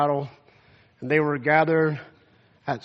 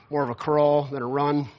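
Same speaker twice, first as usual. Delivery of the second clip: normal talking voice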